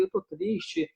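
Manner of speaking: high and soft, a small sad admission; sad little confession. Pretend. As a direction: say it softly, like quietly admitting sadness. (confissão triste, aguda e baixinha)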